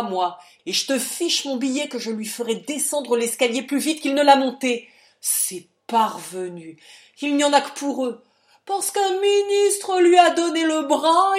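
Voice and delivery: loud, furious ranting father; brutal rage. Acted. Father rages about Jean Robin to his daughter, shouting furiously. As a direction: bellow at her in a blind rage. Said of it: "Il eut un flot de colère brutale".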